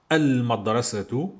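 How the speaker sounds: al-madrasatu, L fully heard; L pronounced. Shown as a demonstration. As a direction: sound the L in "Al-Madrasatu". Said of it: "je dis".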